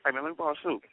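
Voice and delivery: usual speaking voice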